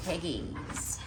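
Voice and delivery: usual speaking voice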